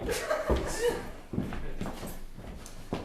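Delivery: breathy high-pitched giggle; a wheezing falsetto laugh; high airy laugh. Acted.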